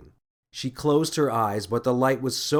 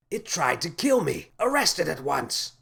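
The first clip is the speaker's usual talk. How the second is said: angry shout; 5 semitones higher than usual. A narrator's reading. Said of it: "shouted the man on the ground".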